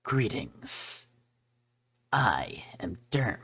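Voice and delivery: lightly whispered Solid Snake impression. Kara greets the player in a hinted Solid Snake voice. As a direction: whisper it like a lightly done stealth soldier. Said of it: "going all in with the Solid Snake voice".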